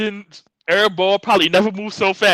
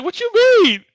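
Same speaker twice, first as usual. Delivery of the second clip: much higher, startled squeak; a sudden high mock-surprised question; surprised Cora; 3 dB louder than usual. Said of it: "And Cora's like".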